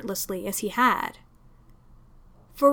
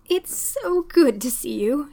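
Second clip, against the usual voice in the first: slow, a touch tearful landlady; landlady hugging the inspector, sniffly; 3 semitones higher than usual. Acted. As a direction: hold back tears through the welcome. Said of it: "sounding sniffly".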